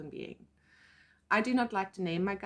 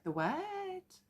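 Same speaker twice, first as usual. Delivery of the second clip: high rising, puzzled question; baffled; 11 semitones higher, 4 dB quieter than usual. Retold or imitated. Hearing of the fifth dimension, baffled and high. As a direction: act out total incomprehension, pitch climbing up.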